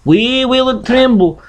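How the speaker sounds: usual speaking voice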